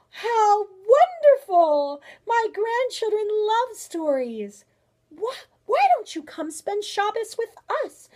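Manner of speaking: high, loud, slow gush of delight. Acted. Grandmother inviting storybook bear to dinner, delighted. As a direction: marvel and invite with great enthusiasm.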